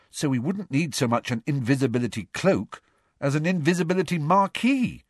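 high-pitched schoolgirl reasoning; Hermione, sceptical. A narrator's reading. Girl explaining they won't fit, sceptical. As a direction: argue the point in a high voice.

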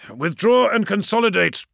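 high, loud barked command; barked order. A narrator's reading. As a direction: bark it into the comms. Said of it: "he barked into the feed".

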